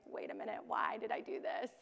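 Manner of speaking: a soft, half-whispered moment of doubt; dismayed aside. Retold or imitated. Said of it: "you're like".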